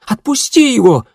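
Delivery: high, loud cry; sharp cry. A narrator's reading. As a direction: cry out to stop her. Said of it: "вскрикнула Едвига". (резкий громкий вскрик)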